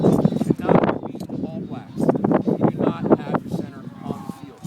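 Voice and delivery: loud, low retold yell; retold shout. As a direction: bark it like he did at the game. Coach retells what he told coach.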